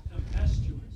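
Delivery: Tempestuous whispered softly, unvoiced. Shown as a demonstration. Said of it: "Thank you, Bob Brown".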